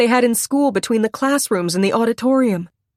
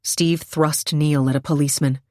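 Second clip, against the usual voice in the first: usual speaking voice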